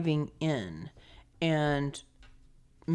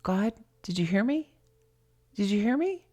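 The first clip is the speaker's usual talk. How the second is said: higher-pitched, rising questions to God; pleading seeker; 6 semitones higher than usual. Acted. Someone praying to God for an answer, pleading. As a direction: lift the voice into a searching question. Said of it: "I can hear someone saying".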